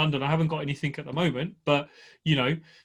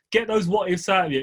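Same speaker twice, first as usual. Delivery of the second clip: usual speaking voice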